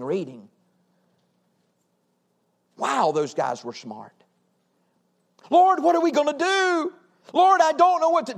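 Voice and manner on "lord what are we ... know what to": high, pleading cry to God; desperate prayer. Acted. Preacher crying to the Lord about the nation, desperate. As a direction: plead upward, at a loss.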